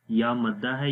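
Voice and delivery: ordinary delivery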